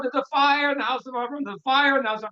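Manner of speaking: high-pitched mock cry of alarm; retold scream. Sage holding on to the ladder, retold scream. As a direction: imitate a cry for help without full volume. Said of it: "And he screams out".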